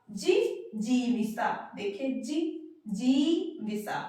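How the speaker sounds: जिजीविसा stretched slowly with a long जी in the middle; slow correct pronunciation. Shown as a demonstration. Teacher slowly models the correct word for students. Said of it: "जिजविसा नहीं होता है".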